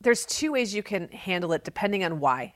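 ordinary delivery

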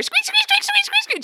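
high squeaky toy squeaks; imitating squeak toy. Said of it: "squeak toy".

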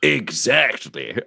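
slow, stretched-out agreement; knowing drawl. Acted. Officer sharing a wink with a reporter, slow and knowing. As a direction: draw the word out conspiratorially.

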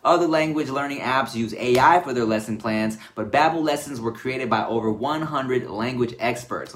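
normal talking voice

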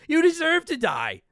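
high, drawn-out mock condemnation; mock outrage. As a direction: pass sentence in exaggerated gamer outrage. Mock rant at joystick players.

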